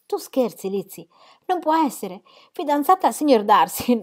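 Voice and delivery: high, loud disbelief; can't believe a word of it; incredulous. Acted. Jane hears of Lizzie's engagement, loud incredulous. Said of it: "si dimostrò assolutamente incredula".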